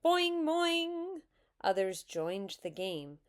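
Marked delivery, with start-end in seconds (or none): 0.1-1.2 two high, loud twangs; high-pitched playground tease; teasing yell. A narrator's reading. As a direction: chant it like bouncing springs. Other children join yelling game.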